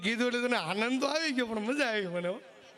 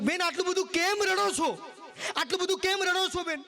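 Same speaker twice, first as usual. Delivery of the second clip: raised, higher voice of the saint; saint's voice; 7 semitones higher than usual. Acted. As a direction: ask like a concerned holy man. Saint asks the weeping woman. (સંતનો ઊંચો અવાજ)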